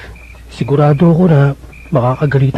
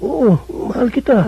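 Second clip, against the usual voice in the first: softer, slow and tender; soft confession; 6 semitones higher, 5 dB quieter than usual. Acted. Vincent to Ivy, softly confessing love. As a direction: say it gently, almost shy.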